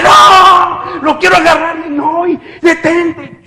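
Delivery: high frantic cries, loud at first; scream, then plead in panic; panicked protest. Retold or imitated. Reliving terror at second foot cut.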